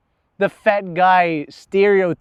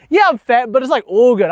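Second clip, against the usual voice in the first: higher, louder put-on nonchalance; acted bravado; 5 semitones higher, 5 dB louder than usual. Man deflecting shame about his weight, acted bravado. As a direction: play it off as totally fine, pitched up. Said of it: "dealt with it".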